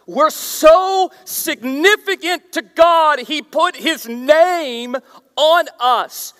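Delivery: slow, high, loud proclamation; preaching at full force. Real, not acted.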